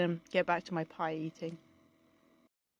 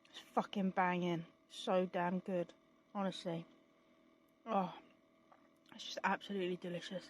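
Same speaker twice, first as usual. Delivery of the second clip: talking through a mouthful of pie; words round a bite of pie; mouth full; 5 dB quieter than usual. Real, not acted. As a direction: chew and talk at once, quietly. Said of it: "not talk with my mouth full".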